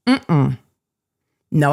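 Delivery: normal talking voice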